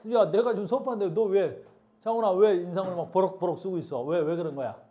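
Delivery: loud classroom call-out; teacher's scolding. Real, not acted. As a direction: demand an explanation from a frowning student, loudly. Teacher calls out frowning student.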